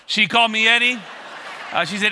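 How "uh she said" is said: normal talking voice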